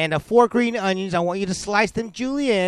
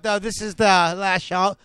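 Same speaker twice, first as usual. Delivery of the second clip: usual speaking voice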